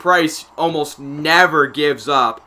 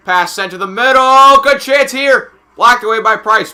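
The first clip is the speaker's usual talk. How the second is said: raised, loud play-by-play; excited call; 8 semitones higher, 8 dB louder than usual. Real, not acted. Announcer calling a good chance, excited loud commentary. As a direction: jump up on the chance.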